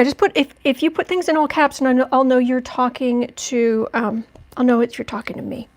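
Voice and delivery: usual speaking voice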